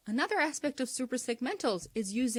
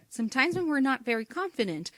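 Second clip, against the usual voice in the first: normal talking voice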